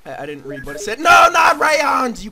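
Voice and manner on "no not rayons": slow, high, loud shout; outraged shout. Acted. Gamer shouting at a silly quiz answer in mock outrage.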